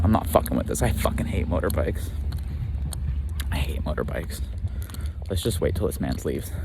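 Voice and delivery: hushed. Real, not acted. Hiding from biker in the dark.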